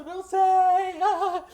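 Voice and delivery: loud, high-pitched bawl, hammed up; exaggerated tearful wail; mock child wailing. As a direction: imitate a kid bursting into tears. Acts himself as crying child.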